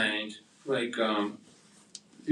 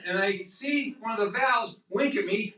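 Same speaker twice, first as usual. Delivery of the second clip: ordinary delivery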